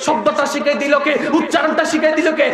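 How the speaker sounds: ordinary talk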